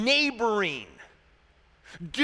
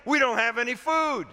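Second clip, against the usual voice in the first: hammed-up grievance, pitched higher; complaining; 5 semitones higher, 4 dB louder than usual. Hungry Israelites say they were brought out to starve, complaining. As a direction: pile up the complaints in mock outrage. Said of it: "And then they're complaining".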